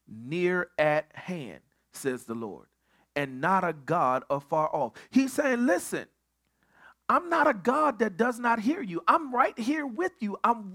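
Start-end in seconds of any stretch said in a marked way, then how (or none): none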